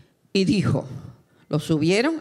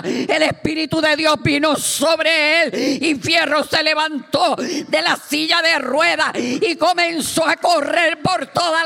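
raised, shouted fervent testimony; full-force preaching; 8 semitones higher, 5 dB louder than usual. Real, not acted. Preacher tells how Fierro rose from the wheelchair, full-force preaching. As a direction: drive each phrase to a ringing peak.

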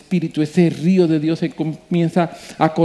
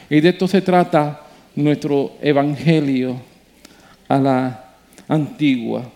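ordinary talk, nothing marked

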